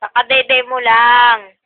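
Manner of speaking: loud, emphatic repeat of Kakadede mo lang. Real, not acted. Repeats loudly to the baby.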